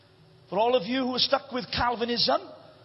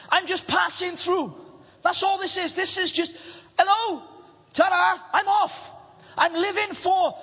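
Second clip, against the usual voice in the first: raised, fervent preaching voice; 5 semitones higher than usual. Acted. Preacher proclaiming to the congregation, fervent preaching.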